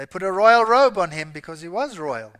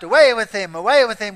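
crowd shout; 9 dB louder than usual. A narrator's reading. Crowd shouting in read scripture.

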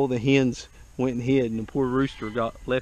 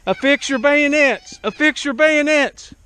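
shouted command; 13 semitones higher, 9 dB louder, slower than usual. Pretend. Mock battle command.

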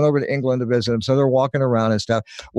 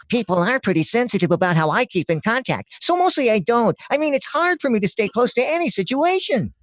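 higher, nasal cartoon porcupine; 9 semitones higher than usual. Voice actor redoing his porcupine ad voice, higher cartoon creature. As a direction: play the touchy porcupine from the ad. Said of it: "the porcupine".

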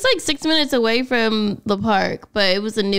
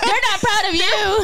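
teasing retort; 10 semitones higher than usual. Pretend.

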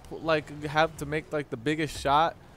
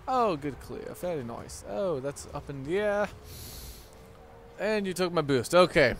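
usual speaking voice

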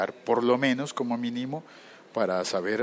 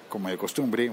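ordinary talk

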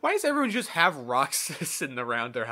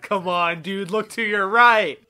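louder, higher, slow exasperated shout; 5 semitones higher, 10 dB louder, slower than usual. Real, not acted. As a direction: yell it in disbelief at the guard. Frustrated at blind guard.

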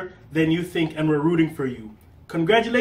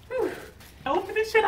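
normal talking voice

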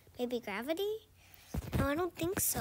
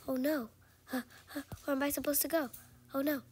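usual speaking voice

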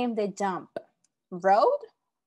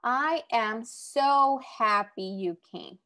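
slow, careful read with even spacing; slow example reading; much slower than usual. Shown as a demonstration. Teacher reading an example sentence to students, slow and careful. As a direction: take the sentence slowly so the class can follow. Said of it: "Next one".

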